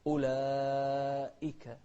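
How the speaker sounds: slow أولئك, long vowel then crisp "i-ka"; clear second hamza. Shown as a demonstration. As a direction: hit the glottal stop before the i in أولئك. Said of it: "نوضح الهمزة الثانية مع كسرها".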